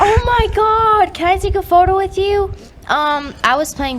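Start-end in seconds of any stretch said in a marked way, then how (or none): 0.0-2.5 high, thrilled gasp of a starstruck fan; imitating a fan who just recognized the singer; excited fan. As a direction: squeal it like you just spotted a celebrity. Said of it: "And then after they're like".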